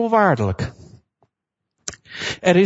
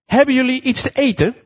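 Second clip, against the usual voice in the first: calling out; 7 semitones higher than usual. Retold or imitated. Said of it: "En die man riep".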